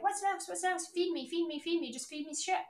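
high, needy mock whine; whiny begging voice. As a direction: beg like a hungry chick. Mocking clients begging to be fed.

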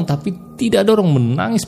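ordinary delivery

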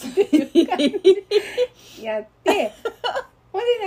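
laughing through the words, slowed; real laughter. Real, not acted. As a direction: chuckle at the absurdity. Speaker laughs at the memory. (笑いながら話す)

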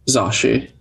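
normal talking voice

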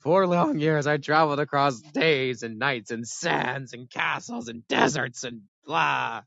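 slow, grand storyteller tone; mock epic storytelling. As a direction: draw out a legend. Mock epic adventure retelling.

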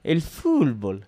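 slow Argentine commentator drawing out "fútbol" as "fulbol". Retold or imitated. Imitating Argentine commentators on a podcast, slow and solemn. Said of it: "ellos dicen fútbol".

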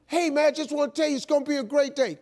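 high, quick upbeat caller; John Walker. Retold or imitated. Sponsor cheering a sleepy man by phone, upbeat. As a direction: brush off the protest with cheer. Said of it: "he called me the exact same way".

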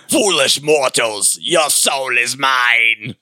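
very slow, drawn-out villain line; slow theatrical villain menace. Retold or imitated. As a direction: menace them slowly. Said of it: "He's like".

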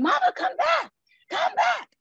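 high, loud shout after her; calling mom back. Retold or imitated. Daughter screaming after her running mom, high and loud.

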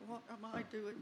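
soft, hushed self-questioning; inner thought. Retold or imitated. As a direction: say it under your breath.

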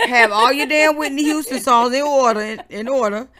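shouted, playful orders to the listeners; loud demand. Real, not acted. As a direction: call it out loud and bossy, half joking. Demanding songs for April's visit.

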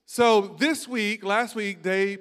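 normal talking voice

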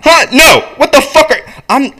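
high, loud outburst; acted furious interruption; angry objection. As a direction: shout back indignantly. Acted script quarrel.

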